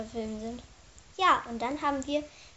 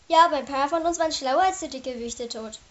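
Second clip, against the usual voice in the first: loud, eager interjection; calling out; 8 dB louder than usual. Acted. Fliegenbart interrupts the tale about the cleverer ones, calling out. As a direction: cut in with a raised voice. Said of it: "rief Fliegenbart".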